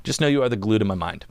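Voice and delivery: usual speaking voice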